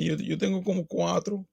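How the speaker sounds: high-pitched mock Spanish-speaker voice; attempted Latin accent. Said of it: "based on the accent that I'm attempting".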